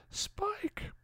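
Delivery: whispered scream; voiceless call, as if far away; hushed cry for help; faint, distant cry. A narrator's reading. Dawn cries out as she's taken away.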